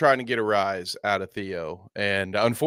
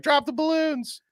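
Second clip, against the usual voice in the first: much higher squeaky plea; mock demand. Everyone pleading with the fan, higher mock demand. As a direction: squeak it out in a comic high voice. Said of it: "they're like".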